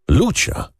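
sudden high-pitched call of a name. A narrator's reading. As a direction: cry out the name in sudden recognition. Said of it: "zawołał Waldemar". (zawołanie wysokim głosem)

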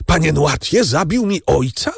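can't believe what you're hearing; horrified disbelief. A narrator's reading. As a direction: Franz confronting the old man, high with horror. Franz learns Noirtier killed his father.

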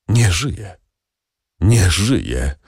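sighed out in hopeless despair, a touch breathy at the edges. A narrator's reading. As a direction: let the words fall out in grief, half-sighed. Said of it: "westchnął Villefort w paroksyzmie rozpaczy".

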